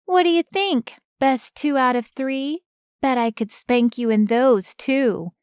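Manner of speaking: put-on high, teasing kid voice; a cheeky nine-year-old daring him; young girl voice. Niece teasing uncle on video.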